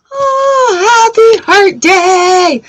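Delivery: very high, drawn-out playful squeal; over-the-top singsong well-wishing; gushing mock greeting. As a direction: gush it in a hammed-up high voice, slowly. Repeats greeting in exaggerated high voice.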